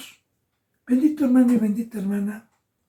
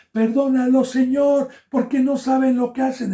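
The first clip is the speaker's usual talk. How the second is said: loud, higher plea to God; pleading cry; 6 semitones higher, 4 dB louder than usual. Acted. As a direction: beg forgiveness for them, crying out. Jesus pleading on the cross. (Jesús en la cruz suplicando, grito agudo)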